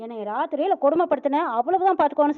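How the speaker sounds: ordinary delivery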